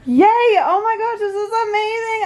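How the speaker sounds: high, delighted gush; giddy burst of joy; thrilled. Real, not acted. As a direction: gush it high, amazed and happy. Thrilled thanks at the close.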